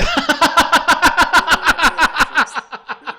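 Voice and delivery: loud, high real burst of laughter; big laugh. Real, not acted. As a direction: laugh out hard at the joke. Caller's lizard joke.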